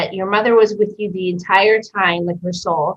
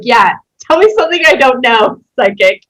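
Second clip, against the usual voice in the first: sarcastic retort; 4 semitones higher, 7 dB louder than usual. Retold or imitated. Mocking the psychic's reading.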